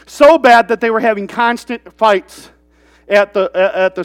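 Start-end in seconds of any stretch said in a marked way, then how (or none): none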